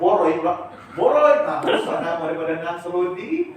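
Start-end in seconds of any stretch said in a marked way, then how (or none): 0.0-1.5 much higher, louder shout of Boh Roy; mock him with the nickname across the schoolyard; teasing high-pitched call.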